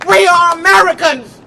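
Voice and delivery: shouted fiery rally oratory; rally speech. Real, not acted. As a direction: preach it to the crowd, full force.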